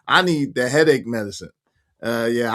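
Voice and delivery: normal talking voice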